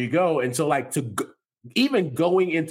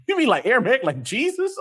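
much higher, amazed and disbelieving; pitched-up astonishment, half laughing; incredulous question; 10 semitones higher, faster than usual. Retold or imitated. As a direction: ask it like you can't believe your ears. Stunned someone speaks Aramaic.

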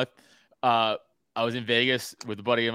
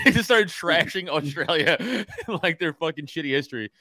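laughing through it; 4 semitones higher than usual. Real, not acted.

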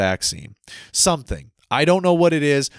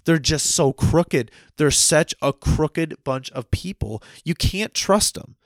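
slow deliberate emphasis; slower than usual. Real, not acted. Ranting about crooked government.

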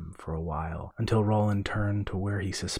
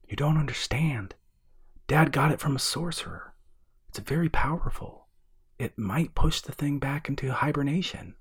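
higher-pitched, pressing argument; urgent insistence; 6 semitones higher than usual. A narrator's reading. Explaining the potion to his reluctant brother, urgent. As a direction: push hard to convince him. Pleading for the dive.

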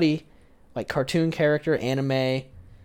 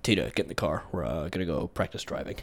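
low, deadpan Bob from the cartoon; Bob impression; 11 semitones lower, 12 dB quieter than usual. Bob telling his daughter to get in the car, low impression. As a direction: drop low for a cartoon dad's voice. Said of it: "Do Bob".

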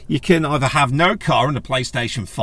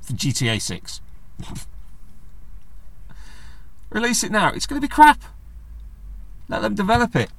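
mock exasperation; 4 semitones higher than usual. Voicing own inner rant.

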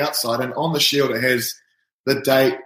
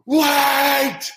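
retold desperate call; 11 semitones higher than usual. Calling to the manager about to leave, high desperate shout. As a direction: shout to stop him before he walks off. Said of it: "I'm like".

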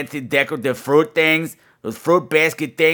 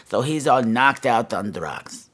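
usual speaking voice

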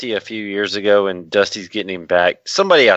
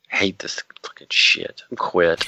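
whispered fed-up grumble on the treadmill; 3 semitones lower, slower than usual. Retold or imitated. Host retells hating the promo, whispered grumble. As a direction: mutter it under your breath, fed up. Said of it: "I was, like, just pissed off".

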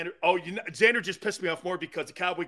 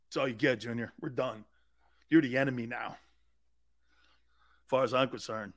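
cold dismissal; 6 semitones lower, 6 dB quieter than usual. Pretend. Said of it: "This is how I would do Doug".

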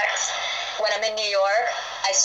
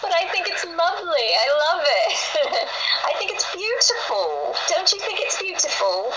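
much higher, lilting posh English accent; 10 semitones higher than usual. Shown as a demonstration. Guest showing off to the English host, delighted English accent. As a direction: gush in a high, plummy English accent. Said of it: "I love to talk with an English accent".

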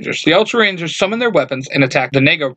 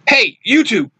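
shout; 8 semitones higher than usual. A narrator's reading.

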